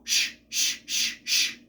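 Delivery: sharp repeated "shh"; a string of forceful shushes; loud shushing. Shown as a demonstration. Someone shushing noisy people, sharp shushes. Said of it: "louder than whatever noise".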